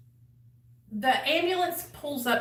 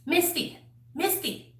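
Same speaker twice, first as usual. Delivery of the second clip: higher, urgent calling of her name; 6 semitones higher than usual. Retold or imitated. Trying to wake an overdosing friend, urgent call.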